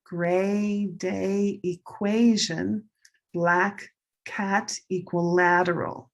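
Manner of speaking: slow, stretched color-word phrases; pronunciation drill. Shown as a demonstration. Teacher shows related math words change vowel, slow drill. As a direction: model each vowel slowly and distinctly. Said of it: "look at this".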